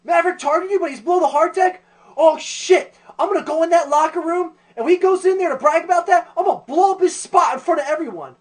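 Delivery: high, loud, gloating yell; overhearing Iceman. Acted. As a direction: shout it like a busybody who just overheard. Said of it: "hears him off in the distance".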